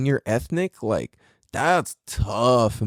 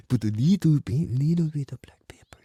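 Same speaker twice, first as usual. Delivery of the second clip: badly faked Filipino accent, fading to whisper; halting Filipino accent, secretive; botched Filipino accent. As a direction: attempt a Filipino uncle accent. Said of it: "can't I not do Filipino accent".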